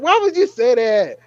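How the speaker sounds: high, loud disbelieving cry; incredulous. Retold or imitated. Reacting to the character's line, high incredulous cry. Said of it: "I was like".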